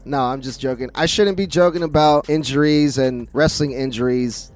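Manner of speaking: usual speaking voice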